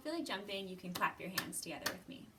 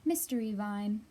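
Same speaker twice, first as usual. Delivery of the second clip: louder, lively storybook read-aloud; reading to children; 8 dB louder than usual. Acted. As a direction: read it out big and bright for a circle of kids. Reads picture book aloud.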